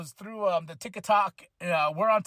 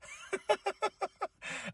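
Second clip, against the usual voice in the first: high laugh; 13 semitones higher, 7 dB quieter than usual. Real, not acted. Laughs at himself.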